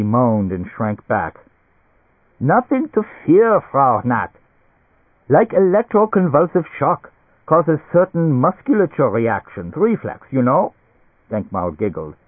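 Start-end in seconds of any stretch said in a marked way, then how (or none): 2.4-10.7 high-pitched, enthusiastic German physician; excitable German doctor. A narrator's reading. Doctor sells therapy to the Nats, excitable German doctor. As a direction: lecture eagerly in a German accent. Doctor reassures scared patient.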